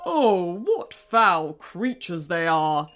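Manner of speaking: spat in disgust. Acted. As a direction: spit the words with contempt. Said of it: "spat the king".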